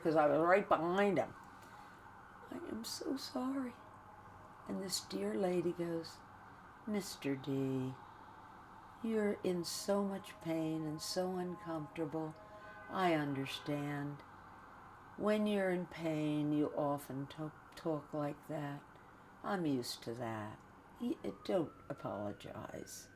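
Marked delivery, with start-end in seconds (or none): 2.5-3.7 soft, high, hushed apology; imitating a father's quietest voice; retold whispering. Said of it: "Now he's whispering". 6.9-23.1 soft, unhurried comforting; imitating a kind nurse; retold gentle reassurance.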